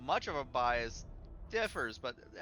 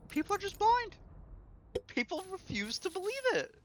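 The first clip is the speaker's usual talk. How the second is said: high-pitched, frustrated outburst; exasperated complaint. Retold or imitated. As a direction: throw it out in high, helpless exasperation. Retold frustrated reaction to the argument.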